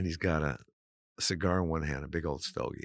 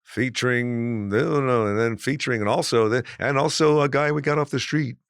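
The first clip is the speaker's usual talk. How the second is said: mock announcer intro; 5 semitones higher, 8 dB louder than usual. Mocking long show intros.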